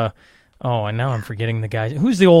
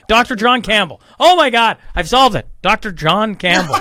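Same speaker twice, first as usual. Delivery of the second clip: loud, higher-pitched eureka outburst; triumphant recall; 6 semitones higher, 5 dB louder than usual. Real, not acted. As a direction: burst out with the name you finally remembered. Suddenly remembers the name he sought.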